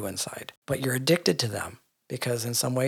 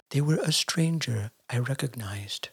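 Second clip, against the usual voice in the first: slow whispered spiritual teacher voice; Eckhart Tolle; 4 dB quieter, slower than usual. Pretend. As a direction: breathe it out like a hushed guru. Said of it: "I'm gonna do my Eckhart Tolle impression".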